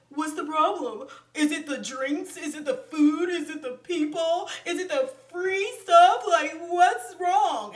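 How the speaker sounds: put-on pleading voice, rising question after question; exaggerated, incredulous fawning; mock ass-kissing. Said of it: "so delved deep into ass kissing".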